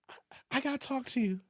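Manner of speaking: soft, quick, timid opener; hesitant wife. Acted. Wife asking husband to talk, softly hesitant. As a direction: play the wife nervously raising trouble, hushed.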